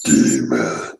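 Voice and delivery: deep demonic growl. Pretend. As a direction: call out like a demon from below. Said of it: "I could do a good demon voice".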